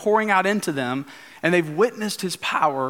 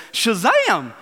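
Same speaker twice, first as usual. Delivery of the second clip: higher, lightly put-on Southern accent; mock panicky Southern deputy, pitched up; a hint of a Southern country boy, higher; 7 semitones higher than usual. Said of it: "in his southern voice".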